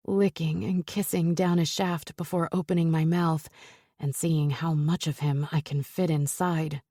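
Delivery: usual speaking voice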